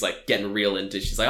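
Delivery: ordinary talk, nothing marked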